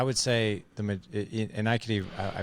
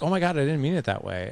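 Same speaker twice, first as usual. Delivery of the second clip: apologetic wife; 7 semitones higher, 4 dB louder than usual. Retold or imitated. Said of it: "And she's like".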